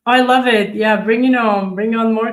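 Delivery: rally the viewers happily; excited cheer. Real, not acted.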